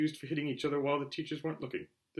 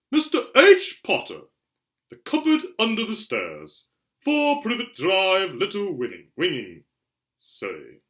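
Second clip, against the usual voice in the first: higher and louder, slow and solemn; reading the address; 6 semitones higher, 11 dB louder, slower than usual. Acted. Harry reading his letter's address, slow and wondering. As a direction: read each line of the address in awe.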